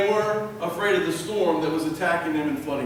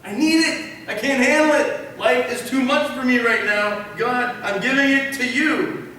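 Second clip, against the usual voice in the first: raised, forceful cry to God; desperate plea; 3 semitones higher, 3 dB louder than usual. Acted. Overwhelmed believer handing it to God, desperate plea. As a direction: plead urgently with rising pitch and force.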